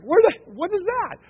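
high, loud startled cry. Retold or imitated. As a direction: jump at the voice from the car. Startled by car voice.

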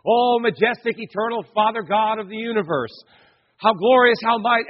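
loud, high-pitched grandiose intoning; majestic prayer. Retold or imitated. Preacher showing how the young man always prayed, majestic. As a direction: proclaim it with exaggerated majesty. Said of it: "he just had this, you know, oh, this sense of majesty".

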